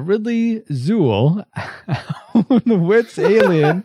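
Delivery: slow, laughing through the words. Real, not acted. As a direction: crack up mid-sentence. Garbled name in joking synopsis.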